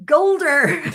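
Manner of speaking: loud, high roar on GULDER; mock bellow. Nurse imitating the shout down the ward, bellowed. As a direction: bellow the word like the shout it names.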